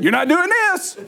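high-pitched, insistent mock scolding; scolding dad. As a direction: play an exasperated father forbidding it. Father scolds son about dirty hands.

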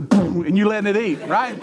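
ordinary delivery

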